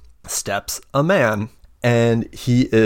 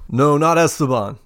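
masculine male voice; 5 semitones higher, 3 dB louder than usual. Acted. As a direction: play a big burly man. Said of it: "this masculine male voice".

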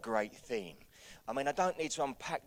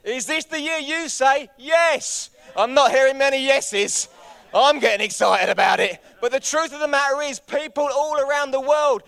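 high, shouted rallying cry; full-force preaching; 12 semitones higher, 15 dB louder than usual. Real, not acted. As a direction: whip the church up to a shouted yes. Rallies church at full force.

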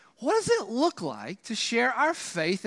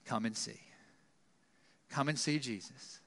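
soft invitation; 10 semitones lower, 13 dB quieter than usual. Shown as a demonstration. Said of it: "as simple as saying".

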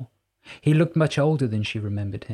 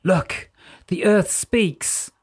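awed exclamation; 7 semitones higher, much slower than usual. A narrator's reading. Morven awed by the light.

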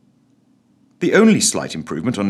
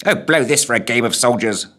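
grumbled under the breath; a lightly voiced ghost grumbling to himself; muttered; 3 semitones higher than usual. A narrator's reading. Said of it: "muttered the figure".

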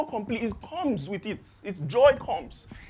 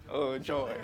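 low, soft chuckle through the words; laughing; 8 semitones lower, 7 dB quieter than usual. Real, not acted. Laughs to himself at the point.

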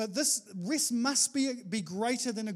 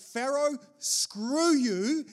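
defiant; 4 semitones higher, 6 dB louder, much slower than usual. Pretend. Defiant quote to Pharaoh.

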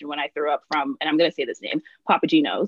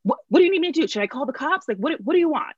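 higher, urgent mock mom; imagined mom reacts to a sobbing daughter; mom mode; 8 semitones higher, faster than usual. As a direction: fire off the frantic mom questions. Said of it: "I would expect you to be like".

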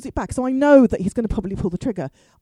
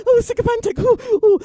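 high, loud gushing ooh; mock fangirl fawning; 11 semitones higher, 6 dB louder than usual. Online fan meets pro wrestling, high mock gushing. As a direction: gush loudly like a starstruck fan. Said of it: "I was a bit sort of".

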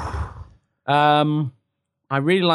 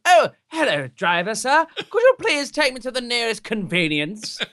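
much higher, hammed-up posh English accent; mock posh British; 9 semitones higher than usual. Mocking how Americans expect British passengers to sound, posh English accent. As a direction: put on a prissy posh British accent, high. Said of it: "I didn't say, like".

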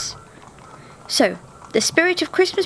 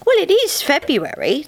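higher, patient spirit voice; mild, reasonable ghost; reasoning spirit; 7 semitones higher than usual. A narrator's reading. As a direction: calmly explain the obvious. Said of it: "the spirit reasoned".

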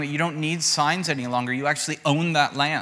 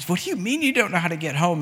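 higher-pitched puzzled question; baffled mom; 5 semitones higher, faster than usual. Retold or imitated. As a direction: imitate a mom taken aback by her child. Baffled mom voiced in story.

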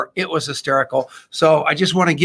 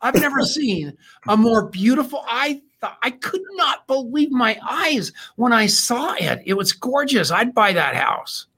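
gushing; 7 semitones higher than usual. Real, not acted. Raving about the house.

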